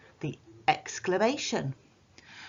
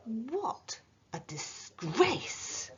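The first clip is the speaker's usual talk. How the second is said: exclaiming; 5 semitones higher, 4 dB quieter, much slower than usual. Shown as a demonstration.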